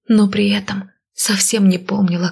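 ordinary delivery, nothing marked

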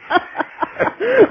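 bright peal of laughter; high laughter. Real, not acted. Daughter laughing at the story.

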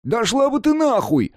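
high, loud yell; shouting. A narrator's reading. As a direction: yell it out angrily. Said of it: "вдруг прокричал Колян".

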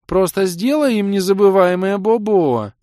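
put-on high, loud baby-talk whine; pouting sweetheart. A narrator's reading. Girl urging her lover to hurt them, high pouting squeal. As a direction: squeal it like a spoiled darling. Pouting girlfriend demands punishment.

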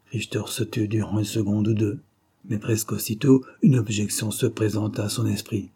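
plain reading voice

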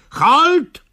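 high, loud barked German order; German command. Acted. Anaconda suddenly orders villagers in German, loud high bark. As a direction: shout it like a German soldier. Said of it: "ludzkim głosem odezwała się".

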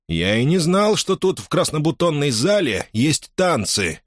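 high mocking call across the yard; shouted from crowd. A narrator's reading. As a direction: yell out a wisecrack, pitched high. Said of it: "выкрикнул из толпы".

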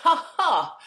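high loud scornful cry; shouted ha ha. A narrator's reading. General crying out at his new opponent, high and loud. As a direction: bark a mocking challenge. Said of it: "cria le général".

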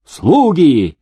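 high, drawn-out summons; calling out. A narrator's reading. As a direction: call servants from afar, long and high. Master calling for his servants.

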